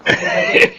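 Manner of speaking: short high sneering laugh; mocking laugh. Villain laughing at Lumen. (tawang nang-iinis)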